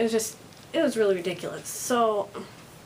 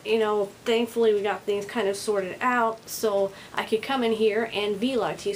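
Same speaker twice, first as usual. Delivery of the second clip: normal talking voice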